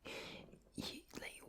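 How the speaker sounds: whispered Glasgow Scots, rolled R's; soft Glaswegian ASMR whisper; Glaswegian accent. Shown as a demonstration. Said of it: "So the Glaswegian accent is one that".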